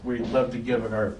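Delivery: put-on bright, loud, high delivery-boy greeting; cheery pitch. Pizza boy greeting the hungry customer at the door, loud and cheery. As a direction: sell the slogan with gusto.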